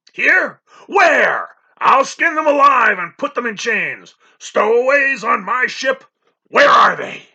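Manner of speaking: high, loud raging shouts; furious roaring. A narrator's reading. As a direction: rage and threaten at full voice. Captain raging again.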